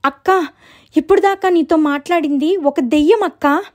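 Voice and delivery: high, urgent little-sister voice; flustered storybook sister; acted panic. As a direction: break the ghost news in alarm. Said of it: "ఎందుకంత కంగారు పడుతున్నావ్".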